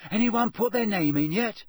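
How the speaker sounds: eager. A narrator's reading. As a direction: ask it eagerly, bursting to know. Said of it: "Ron asked a third-year girl eagerly".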